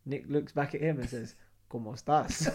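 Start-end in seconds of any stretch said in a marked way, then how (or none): 1.7-2.5 comic copycat Spanish, about to laugh; mimicked parroting. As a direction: parrot the greeting back for laughs. Mimics Nick parroting the greeting back.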